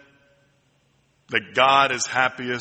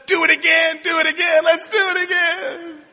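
put-on excited child. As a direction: cheer it like a thrilled little boy. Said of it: "And Paul said".

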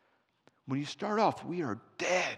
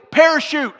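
urgent shout; 13 semitones higher, 16 dB louder than usual. Retold or imitated. Urgent shout in crashing plane.

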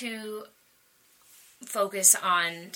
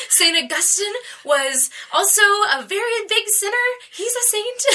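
giggling quote, higher and louder; laughing; 11 semitones higher, 8 dB louder than usual. Real, not acted. Daughter giggles while quoting her mom's advice. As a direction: laugh your way through the words.

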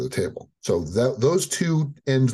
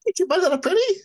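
witch voice; faster than usual. Retold or imitated. Echoing the witch line.

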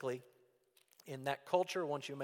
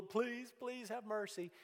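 mock begging; 8 semitones higher, 4 dB quieter, slower than usual. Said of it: "he's just drool".

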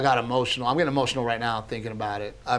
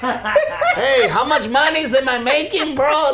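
high, loud laughing bro voice; mock swagger, laughing through it; cocky Pollito; 12 semitones higher, 7 dB louder, slower than usual. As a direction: demand your cut like a cocky bro.

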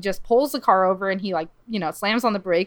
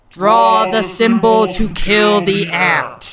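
slow, loud, eerie command; commanding alien voice; 7 dB louder, much slower than usual. Acted. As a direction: order it slowly like an alien. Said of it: "this voice commands him".

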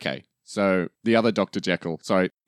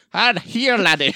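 much higher, loud Scottish villain; Scottish accent; 10 semitones higher, 4 dB louder, slower than usual. Shown as a demonstration. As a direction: play a Scottish Hyde. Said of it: "What was that? Say that again".

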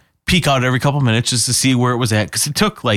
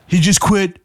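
lightly voiced Trump, name-dropping proudly; Donald Trump voice; 5 semitones higher than usual. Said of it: "That's how you do a Donald Trump impression".

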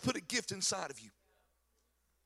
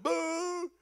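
higher mock boo; 8 semitones higher than usual. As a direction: toss out a playful boo.

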